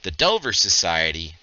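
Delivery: measured storyteller with weighty pauses; game master narration. Acted. Game master briefing the hired mercenaries, measured scene-setting narration. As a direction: set the scene in deliberate, separated phrases.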